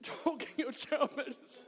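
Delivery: high, slow, loud words through laughter; helplessly cracking up while speaking; laughing. Real, not acted. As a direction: squeeze the words out mid-giggle. Laughing fit at own joke.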